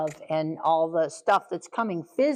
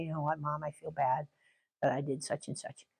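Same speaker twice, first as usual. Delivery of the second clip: put-on quiet, lower, sheepish child; child's soft apology; 5 semitones lower, 9 dB quieter than usual. Child coming back to Mom to apologize, quiet. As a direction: own up softly, eyes down.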